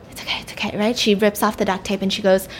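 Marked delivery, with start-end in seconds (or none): 0.1-0.8 soft soothing whisper. Retold or imitated. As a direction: whisper to calm them. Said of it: "and she's like".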